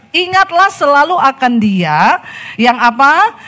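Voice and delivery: loud, high fervent scripture reading; fervent preacher voice; preaching at full force. Acted. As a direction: proclaim the verse to the congregation.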